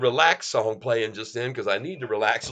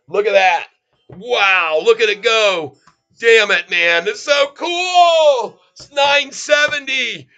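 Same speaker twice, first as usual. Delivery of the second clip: high, loud whooping cheer, slowing right down at the end; ecstatic shouting; 9 semitones higher, 9 dB louder, slower than usual. Real, not acted. Trader cheering his stock's run to viewers, shouting with joy. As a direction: yell it out as the price explodes. Stock spiking live on stream.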